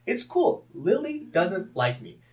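very high, loud mock-exasperated outburst; loud and high, driving the point home; emphatic rebuttal. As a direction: shout it like an obvious answer. Acts out blunt alternative explanation.

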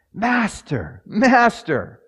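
high, rising call of Master; desperate cry. Acted. Frightened disciples calling to sleeping Jesus, high desperate cry.

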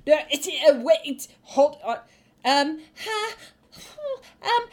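flustered choking splutter. Acted. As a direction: stammer as if coughing up water. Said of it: "is spluttering. She's still coughing up water".

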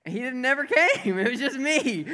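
high, loud, quick punchline; laughing exasperation. Retold or imitated. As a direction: cry out the embarrassment through a laugh. Punchline of embarrassing story, laughing.

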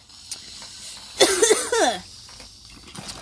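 hard hacking cough, smoke down the wrong pipe; coughing fit. Real, not acted. Storyteller chokes on smoke mid-story, hacking cough. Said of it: "some smoke went down the wrong pipe".

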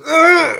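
loud, high imitation of a mad baby; frustrated toddler grunt. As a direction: mimic a child caught in the act. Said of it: "He gets frustrated".

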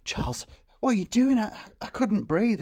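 high-pitched mock-startled question; playfully imitated alarm; acted-out startled woman. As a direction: put on a high, flustered voice. Acting out woman's alarm in film retelling.

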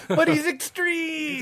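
laughing high and slow. Real, not acted. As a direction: squeal it through laughter. Mock hype over Adam X.

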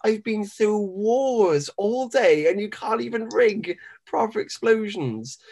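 high, slow, indignant complaint; exasperated wrestler. Acted. Podcaster voices wrestler let down by the explosions, high exasperated protest. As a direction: protest in drawn-out disbelief, pitched high. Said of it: "he stands up with his arms out".

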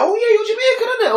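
high-pitched quote of a woman; imitating her remark. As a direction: mimic her casual question way up high. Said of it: "and then she's like".

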